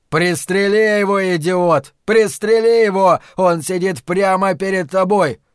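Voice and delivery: loud, high-pitched angry order; roaring. A narrator's reading. Flagg ordering Lloyd to shoot the man before him, roaring. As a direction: bellow it at a useless underling. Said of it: "проревел Флэк".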